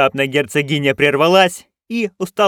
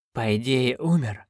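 softer, slightly husky reply; a touch of hoarseness; 5 dB quieter than usual. Acted. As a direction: answer with a faint rasp, dazed. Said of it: "с некоторой хрипотцой в голосе".

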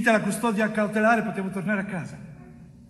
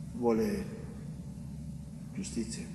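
breathy whisper, barely voiced; 8 semitones lower, 11 dB quieter than usual. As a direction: let the word fall to a whisper. Bitter word about mother's wish.

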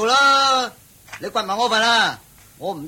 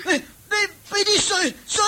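desperate pleading; 10 semitones higher than usual. Acted. Parched man begs for water.

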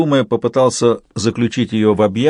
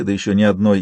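ordinary delivery, nothing marked

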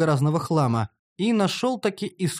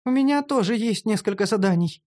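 higher female character voice, mildly resigned; understated sigh in a raised pitch; sighing gnome; 6 semitones higher than usual. A narrator's reading. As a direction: admit it with a touch of weariness. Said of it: "со вздохом поведала гномка".

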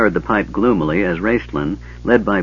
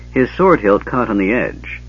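ordinary delivery

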